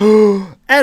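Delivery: loud, high theatrical gasp; exaggerated sharp intake of breath; mock gasp. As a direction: gasp as if scandalized. Feigns shock at gun rights.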